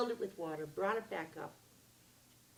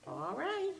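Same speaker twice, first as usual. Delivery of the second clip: little man agreeing; 13 semitones higher than usual. Acted. Little man grants wish.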